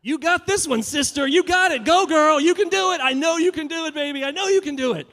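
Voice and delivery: hammed-up cheer from the sidelines; mock cheerleading.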